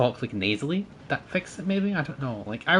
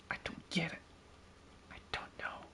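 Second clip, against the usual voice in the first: hushed, voiceless whisper; baffled whisper; 5 semitones higher, 20 dB quieter than usual. Real, not acted. Gamer giving up on the accent, defeated whisper.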